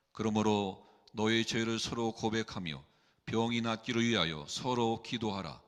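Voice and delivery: ordinary talk, nothing marked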